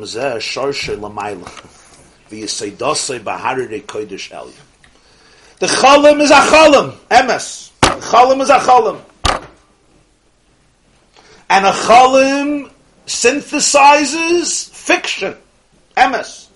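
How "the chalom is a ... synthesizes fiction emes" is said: very high, loud, drawn-out declaration; extremely emphatic. Retold or imitated. Teacher voicing the Rebbe on the paradox, emphatically shouted. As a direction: proclaim it at full force. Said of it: "but he's emphatic about the paradox".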